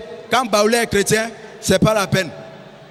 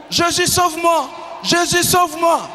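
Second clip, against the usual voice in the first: higher, panicked pleading to Jesus; frightened cries; 6 semitones higher than usual. Retold or imitated. Kofi cries to Jesus as witches chase him. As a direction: cry out for rescue in a dream. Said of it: "il a commencé à crier".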